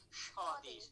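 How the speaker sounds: স্বদেশ said as shodesh, with the palatal sh; স্বদেশ with a clear sh at the start; sh sound at the start. Shown as a demonstration. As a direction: open স্বদেশ with sh, not s. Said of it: "তালব্য স দিয়ে".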